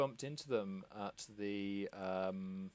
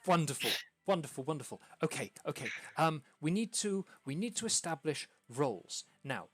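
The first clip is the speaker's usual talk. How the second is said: fast, excited stammering impresario; lightly voiced flustered theatre manager; excited hostess; 5 semitones higher, 11 dB louder than usual. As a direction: babble the plan out breathlessly. GM voicing hostess casting roles.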